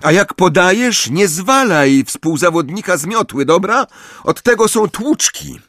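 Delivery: high, forceful scolding; shouted telling-off. A narrator's reading. Angelina correcting Ron's passing, shouting high. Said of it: "krzyknęła Angelina".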